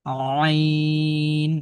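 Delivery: আইন held long, pressed from mid-throat; Arabic throat letter, pressed; pressed throat ain. Shown as a demonstration. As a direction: squeeze আইন out of the throat, sustained. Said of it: "এই যে একটু চাপ দিচ্ছি".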